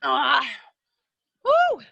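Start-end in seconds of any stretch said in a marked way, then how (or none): 1.4-1.8 excited whoop. Real, not acted. Coach hypes class mid workout.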